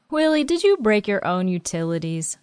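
ordinary delivery

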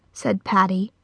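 ordinary delivery, nothing marked

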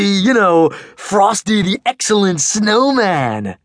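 put-on high, loud goofy boy voice; big teasing kid. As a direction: tease your friend loudly, laughing it off. Huge boy Max teasing.

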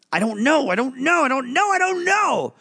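put-on a boy cornered, spiralling upward; rising panic. Gus overwhelmed by both voices, building panic.

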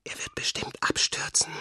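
anxious whisper; frightened whisper. A narrator's reading. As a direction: breathe it to her brother in fear. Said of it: "sagte wieder leise".

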